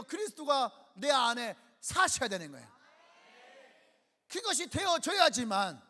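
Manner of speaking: loud, slow, forceful preaching; preaching at full force. Acted. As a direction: drive it home from the pulpit. (힘차게 외치는 설교)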